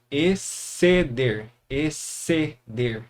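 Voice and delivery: "Exceder" slowly as e, ce, der; slow sounding out. Shown as a demonstration. Teacher drawing attention to the single sound, slowly sounded out. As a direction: sound out "exceder" slowly, one syllable at a time. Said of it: "mas olha o som".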